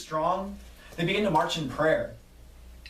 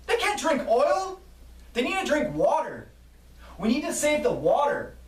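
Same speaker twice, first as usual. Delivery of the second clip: pleading yell; 11 semitones higher than usual. Acted. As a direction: plead with the workers at full voice. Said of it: "A woman yells".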